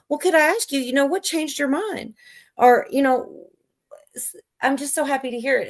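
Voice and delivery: high-pitched, tentative questioning; curious, happy woman. Acted. Woman asks her bird what changed its mind, happily curious. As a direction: ask it in the lifted voice of the pleased owner. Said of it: "And she's like".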